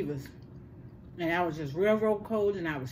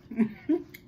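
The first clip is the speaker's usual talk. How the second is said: short laugh; 4 semitones higher than usual. Real, not acted. Laughing at the nurse's doubt.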